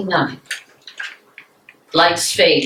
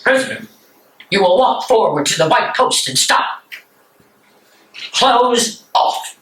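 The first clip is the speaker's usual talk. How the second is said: firm, higher commands; curt guard orders; 5 semitones higher than usual. Acted. Execution guard ordering Bonhoeffer forward, curt and higher. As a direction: order the prisoner coldly.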